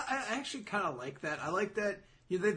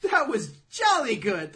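loud, high, slow and jolly; hammed-up approval; 6 semitones higher, 10 dB louder, slower than usual. Hero shrugging off saving humanity, hammed-up jolly. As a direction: belt out mock-hearty praise.

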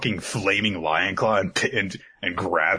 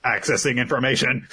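lightly voiced computer readout; robot voice; 6 semitones higher than usual. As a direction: announce it like a machine. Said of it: "Robo Yuno".

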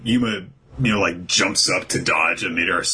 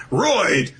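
yelled attack name, pitched higher; called-out attack name; 11 semitones higher than usual. Retold or imitated. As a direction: call it out like an anime attack. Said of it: "while going".

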